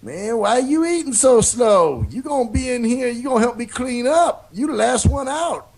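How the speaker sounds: loud, high scolding janitor; custodian voice. Retold or imitated. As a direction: call it out like a gruff custodian. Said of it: "They used to say".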